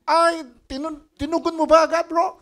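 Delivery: very high, loud thrilled question; excited squeal. Retold or imitated. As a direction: burst out in delighted disbelief. Thrilled reaction to money news.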